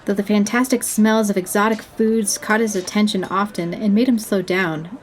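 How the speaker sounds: ordinary delivery, nothing marked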